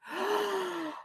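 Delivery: high, audible acted inhale; deep breath. Muskrat readies to dive into the water, gulping air. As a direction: fill the lungs before diving. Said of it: "he took a deep breath".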